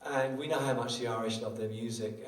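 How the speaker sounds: usual speaking voice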